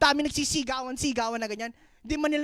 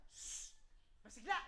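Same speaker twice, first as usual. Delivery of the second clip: imitated crowd hushing; 3 semitones lower, 18 dB quieter than usual. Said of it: "Ang sabi sa kanya".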